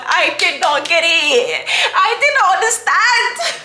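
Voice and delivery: laughing, rising sharply on the last line; laughing confession. Real, not acted. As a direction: laugh through the admission. Laughs about misunderstanding men.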